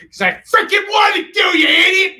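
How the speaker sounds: high-pitched joke screaming; hammed-up furious outburst; mock angry shout. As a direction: yell it in pretend outrage, very high and loud. Mock outburst, then says just kidding.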